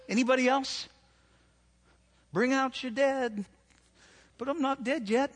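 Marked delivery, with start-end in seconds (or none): 2.3-5.3 Monty Python quote. Retold or imitated. Preacher quoting Monty Python to the crowd, playfully. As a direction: toss off a movie quote playfully. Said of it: "I can just quote lines from movies".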